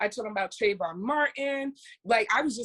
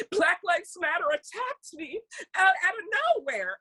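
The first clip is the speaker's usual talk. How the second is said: higher, whiny fake-upset voice; mock victim; 8 semitones higher than usual. Mocking the attacker's claim to reporters, higher and whiny.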